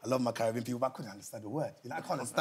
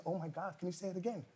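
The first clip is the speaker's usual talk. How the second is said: much softer imitation of an American cashier; 12 dB quieter than usual. New York cashier asking for a repeat, imitated amazement. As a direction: play an amazed cashier, hushed. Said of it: "she kept saying to me".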